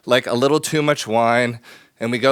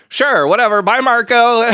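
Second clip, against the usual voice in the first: breezy, dismissive, higher and louder; dismissive brush-off; 8 semitones higher, 3 dB louder than usual. Retold or imitated. Brushing off the Italian date at the door, breezy and dismissive. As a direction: wave him off with a careless goodbye.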